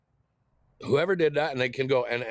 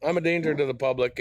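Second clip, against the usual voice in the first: usual speaking voice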